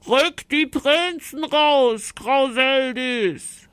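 high, slow, ringing demand; calling out. A narrator's reading. Knight demanding the princes from the giantess, calling out. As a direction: call out the demand, stretching the words. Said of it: "rief die Ritterin".

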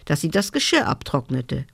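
plain reading voice

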